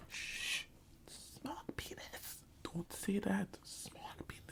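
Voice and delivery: slow, shushing whisper; hushed whisper. Retold or imitated. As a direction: shush the pupil in an urgent whisper. Teacher hushing the pupil, voiced.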